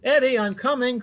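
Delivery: high, loud call from afar. A narrator's reading. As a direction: call across the woods. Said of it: "called Mom".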